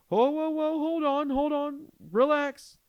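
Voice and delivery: high, loud drawn-out yell; hammed-up alarmed cop yelling; cop's shouted command. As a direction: shout to halt someone rushing at you. Retold cop halting him.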